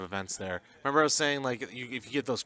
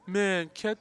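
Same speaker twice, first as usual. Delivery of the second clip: whiny complaining player, pitched higher; complainer voice; 7 semitones higher, slower than usual. Acted. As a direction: whine like a sore loser, higher. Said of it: "I like your complainer person voice".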